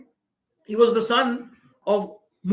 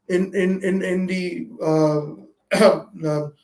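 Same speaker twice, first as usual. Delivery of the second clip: ordinary talk, nothing marked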